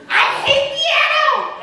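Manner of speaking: shrieking I hate piano; child's screaming tantrum. Retold or imitated. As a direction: belt it out like a furious seven-year-old. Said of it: "it was screaming".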